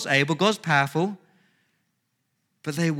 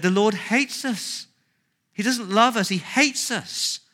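higher, slowed whiny moan; whingeing complaint; 6 semitones higher than usual.

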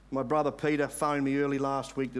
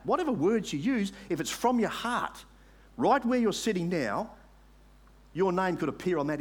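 raised, emphatic altar-call appeal; impassioned preaching; 4 semitones higher, 3 dB louder than usual. Real, not acted. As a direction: urge the congregation with rising force. Preacher urges congregation to convert.

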